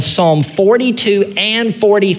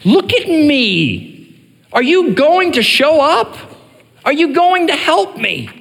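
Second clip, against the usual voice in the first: pleading psalmist, pitched higher; crying out to God; 9 semitones higher than usual. Retold or imitated. Psalmist crying out to God in torment, pleading high. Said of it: "him crying out to God".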